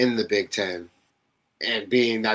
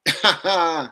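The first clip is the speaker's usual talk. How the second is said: high, amused cackle; gloating laugh; 8 semitones higher, 4 dB louder than usual. Real, not acted. Host ribbing a rival team, gloating laugh. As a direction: crack up at your own jab. Laughing at his own jab.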